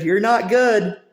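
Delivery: mock coach barking a cut; mock dismissive yell. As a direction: shout them off the roster. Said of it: "took Alex Carter and said".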